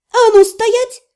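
high, loud bark; barked command. A narrator's reading. Вики рявкает на пятящуюся девушку. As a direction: stop her in her tracks. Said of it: "рявкнула я".

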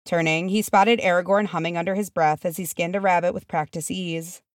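usual speaking voice

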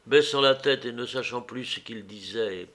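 usual speaking voice

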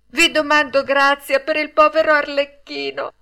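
high, loud appeal; pleading. A narrator's reading. Pinocchio begs mercy for poor Arlecchino, high and pleading. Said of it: "con voce supplichevole".

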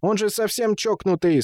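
high, scratchy protesting voice; creaky sandpaper voice. A narrator's reading. Woman holding Темул's ear objects to Гулям, creaky high protest. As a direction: rasp out the complaint at a high pitch. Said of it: "сказала она скрипучим, как наждак, голосом".

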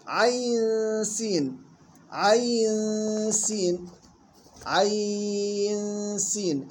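عين stretched very long, six counts, then سين; six-count madd demo. Shown as a demonstration. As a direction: hold the ya in عين for six full counts, very slowly. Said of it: "أو ست حركات". (مد عين ست حركات ببطء شديد)

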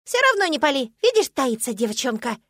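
high, loud squeal of a little squirrel; squirrel squeak. A narrator's reading. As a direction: squeak insistently at the top of the voice. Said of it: "Прямо пискнул белк". (пронзить высоким беличьим писком)

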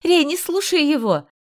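high desperate scream. A narrator's reading. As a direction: shout a warning across fire. Narrator screams to husband. (отчаянный крик)

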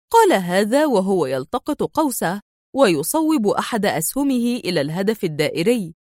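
ordinary delivery, nothing marked

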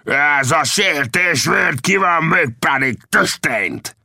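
high, drawn-out angry yell; enraged bellow. A narrator's reading. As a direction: shout a threat of instant revenge. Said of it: "ordította a bandita".

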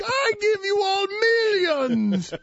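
put-on high, slow, hammy Russian accent; terrible Russian accent. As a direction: overblown Russian tycoon accent. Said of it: "The terrible Russian accent by me".

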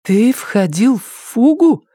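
high, slow and anxious; worried question. Acted. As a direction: ask with sudden worry. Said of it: "не услышала гордости, беспокойство".

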